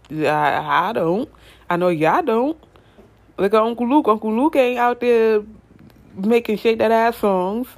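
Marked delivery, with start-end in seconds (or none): 3.4-7.7 louder and higher, poking fun; loud teasing. Real, not acted. Teasing viewers about [name]'s songs, loud and amused.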